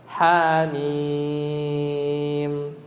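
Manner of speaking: "Ha mim" with a very long ee in "mim"; sung, stretched "Ha mim"; elongated recitation. Shown as a demonstration. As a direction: chant "Ha", then hold "mim" on a level note. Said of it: "Ini juga sama".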